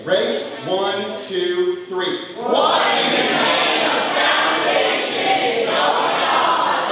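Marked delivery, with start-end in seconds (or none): 2.3-6.9 loud, drawn-out shout in unison; stretched, raised chant-like holler; group yell. Shown as a demonstration. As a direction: yell it out slowly with the crowd. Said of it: "So we gotta yell it out together".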